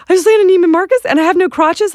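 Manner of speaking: high, loud, incredulous squeal; outraged high-pitched surprise, as if she can't believe it; shocked disbelief. Retold or imitated. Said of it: "I'm like".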